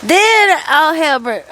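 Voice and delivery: ordinary delivery, nothing marked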